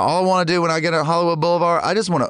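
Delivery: hammed-up Carolina man's accent, a bit fast. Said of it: "I heard the man's accent".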